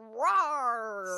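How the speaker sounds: deep, slow growling roar; monster roar. Acted. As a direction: roar low like a beast. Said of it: "I'm scary".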